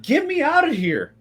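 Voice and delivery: very high pleading cry; pleading trapped man. Acted. Trapped man begs the party to pull him out, high pleading. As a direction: beg to be let out, high. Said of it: "he's like".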